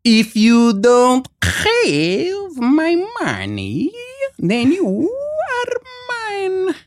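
high, very slow mock Russian threat, cracking at the top; imitating Malkovich's Russian accent again. Actor retelling the second take, high slow Russian accent. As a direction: drag it out high like a hammy villain. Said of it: "they go".

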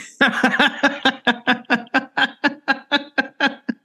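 real, sustained giggling fit; long laugh. Real, not acted. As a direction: crack up at your own story. Cracks up after retelling spat.

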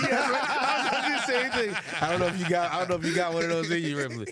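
high laughing tease. Real, not acted. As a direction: giggle through the words. Teasing through laughter.

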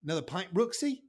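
loud, high Australian holler; boisterous Australian accent; Australian pub greeting. Retold or imitated. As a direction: hail a regular across the pub in Aussie. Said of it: "in Australia, everybody calls me Brooksy".

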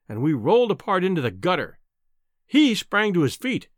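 plain reading voice

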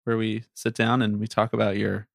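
soft, gentle counselor tone; therapist voice. Pretend. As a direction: speak like a calm therapist. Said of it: "therapist voice the entire time".

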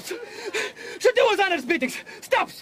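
slow, tearful giving in; crying plea. Acted. Prisoner giving in to the captain, slow and tearful. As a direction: break down and agree through tears. Sobbing surrender under the noose.

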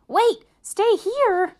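loud, high, drawn-out double take; alarmed disbelief. A narrator's reading. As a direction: blurt it out slowly in shocked disbelief. Arthur suddenly realizes teacher is moving in.

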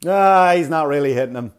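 loud, grumbling armchair dad; dad watching wrestling. Acted. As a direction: complain loudly at the TV like a dad. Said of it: "Like a dad watching wrestling".

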